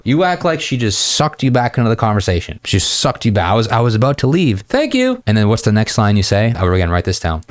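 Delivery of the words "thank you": much higher, lightly voiced girlish thanks; a hint of a grateful girl. Man voices the woman he complimented, girlish thanks. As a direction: chirp her quick thank you.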